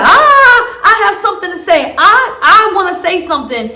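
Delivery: a hammed-up loud guest grabbing the floor; loud pushy talker. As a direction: barge in as a loud, laughing show-off. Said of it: "they're just loud".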